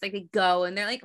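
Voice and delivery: ordinary delivery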